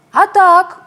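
высокий громкий выкрик; крикни тоненько и звонко; тоненький крик. A narrator's reading. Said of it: "тоненько кричал кто-то". (тоненький крик лягушонка)

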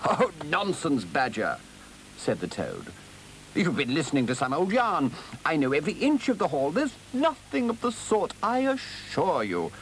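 blustering, high-pitched Toad; dismissive Toad. Acted. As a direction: scoff as a boastful, excitable Toad.

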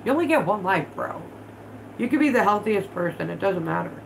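ordinary delivery

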